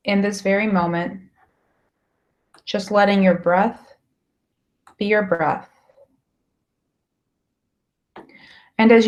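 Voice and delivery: measured meditation guide with long pauses between phrases; guided meditation. Acted. Guiding listeners through a lake meditation, calm and unhurried. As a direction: guide them calmly, phrase by phrase, leaving space to breathe.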